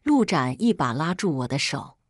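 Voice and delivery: ordinary delivery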